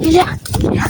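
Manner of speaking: hushed whisper, hiding close by; whispering while hiding. Real, not acted. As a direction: whisper so the seeker won't hear. Hiding from a seeker in game. (chuchoté, caché)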